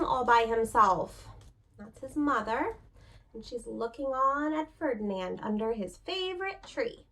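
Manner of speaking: patient picture-book voice with pauses; reading to children. A narrator's reading. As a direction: read it like a teacher at story time.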